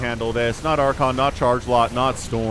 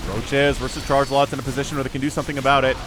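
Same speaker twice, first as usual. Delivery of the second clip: ordinary delivery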